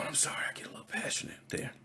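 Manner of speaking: fast, breathy whispered apology; sheepish whisper. Real, not acted. Apologizing to viewers after a passionate rant, whispered.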